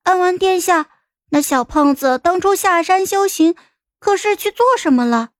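put-on high, curious young girl's voice; little girl piping up to question an elder; inquiring child. A narrator's reading. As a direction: ask politely in a high child's pitch. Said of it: "我就开口问道".

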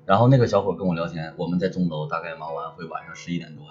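ordinary delivery

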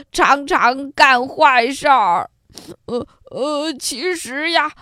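hammed-up sobbing wolf, gulping between phrases; crying while talking. A narrator's reading. Old wolf begging the giraffe to pull his teeth, crying. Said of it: "他一边哭一边说".

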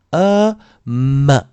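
呃 as "uh", then 么 as "mmm-uh"; separated demo, 么 ending in schwa; schwa added to M. Shown as a demonstration. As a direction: say 呃 alone, blend it into 么. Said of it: "再加一个schwa".

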